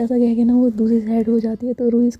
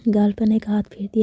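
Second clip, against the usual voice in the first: ordinary delivery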